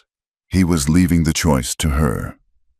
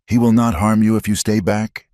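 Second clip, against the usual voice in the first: mild yell; 6 semitones higher than usual. Acted. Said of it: "Martha yelled".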